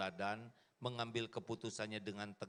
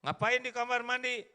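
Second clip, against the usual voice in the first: puzzled husband; 12 semitones higher, 7 dB louder than usual. Acted. Husband asks wife, acted quote.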